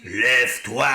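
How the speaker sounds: génie malfaisant, ordre sec et menaçant; grosse voix de génie. A narrator's reading. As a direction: ordonne comme un génie maléfique. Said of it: "avec sa grosse voix". (grosse voix de génie menaçant)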